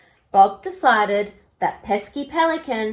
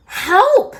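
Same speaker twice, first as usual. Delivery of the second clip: high, loud yelp; cry for help; 11 semitones higher, 8 dB louder than usual. A narrator's reading. Bob the dog yelps for help. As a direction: yelp it out in alarm. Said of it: "said Bob".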